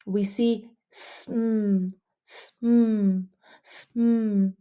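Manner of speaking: "sm" drawn out slowly; slow blend reading. Shown as a demonstration. Teacher reading the blend slowly for students. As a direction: stretch "sm" for readers. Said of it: "let's read this one".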